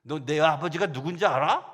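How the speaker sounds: loud, rising challenge ending on 알아; defiant child's challenge. Retold or imitated. Girl challenging her teacher, loud and defiant. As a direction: throw the question at the teacher, head up. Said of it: "이렇게 얘기했대요".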